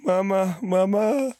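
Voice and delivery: high pleading cry; dying words. Acted. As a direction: plead weakly for mother. Dying patient's last words.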